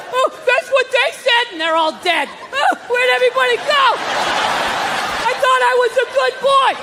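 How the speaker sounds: put-on high, lost, pleading old man voice; bewildered old man. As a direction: sound like a confused old man abandoned.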